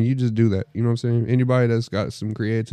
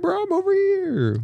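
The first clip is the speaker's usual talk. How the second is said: high-pitched mock plea for attention; squeaky overlooked-fan cry; pleading fan call. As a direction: call out high, desperate to be noticed. Fan begging the rapper to notice him.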